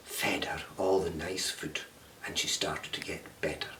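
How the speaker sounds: soft whispered ending; storyteller whispering the happy ending. A narrator's reading. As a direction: hush it gently to close the story.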